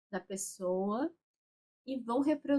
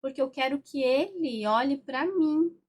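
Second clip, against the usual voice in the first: a mock-irritated, rising, louder protest; irritated retelling; 5 semitones higher, 6 dB louder than usual. Representative wanting her father to look at her, irritated. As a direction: replay her annoyed complaint, half-acted. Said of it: "um gesto mais irritada".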